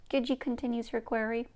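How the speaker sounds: ordinary delivery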